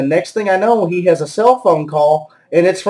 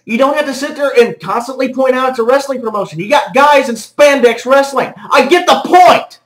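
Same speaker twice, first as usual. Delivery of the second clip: exasperated rant; 7 semitones higher than usual. Real, not acted. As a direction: build to a fed-up outburst. Exasperated rant continues, builds.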